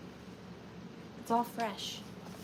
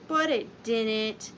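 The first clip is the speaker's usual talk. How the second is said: slow deadpan; 3 semitones lower, much slower than usual. Acted. Deadpan repeat of a punchline.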